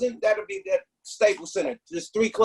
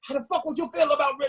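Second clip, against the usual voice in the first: fast, higher mock cop threat; tough talk; 7 semitones higher, faster than usual. Cop threatening him over Rick, mock tough talk. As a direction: push it quick and high like a bully cop. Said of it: "he's tough talking me".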